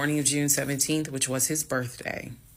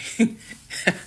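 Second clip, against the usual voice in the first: short breathy chuckle; laugh; 9 semitones higher, 3 dB louder than usual. Real, not acted. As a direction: let out a quick laugh. Delighted at having grounds.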